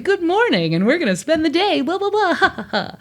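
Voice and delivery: hammed-up morning radio patter; morning radio host. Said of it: "we've been doing two hours of".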